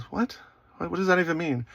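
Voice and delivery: high-pitched, incredulous confusion; baffled. Retold or imitated. Boyfriend answering girlfriend's accusation, high and baffled. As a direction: act out being completely puzzled.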